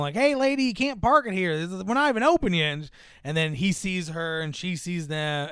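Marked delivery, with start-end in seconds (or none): 0.1-2.7 higher, louder calling out; mock mechanic call. Mechanic calling to the woman parking, higher loud. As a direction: shout across the lot. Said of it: "You hear the mechanic going".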